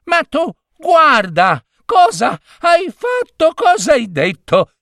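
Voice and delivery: very high-pitched, spluttering scolding; outraged yelling. A narrator's reading. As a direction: cry out at a foolish husband in disbelief. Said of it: "gridò Giorgina". (grida acute e indignate)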